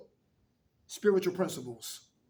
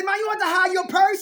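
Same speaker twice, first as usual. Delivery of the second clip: higher, louder playful jab; teasing son; 11 semitones higher, 10 dB louder than usual. Retold or imitated. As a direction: needle Ma about her purse. Retells teasing his mother.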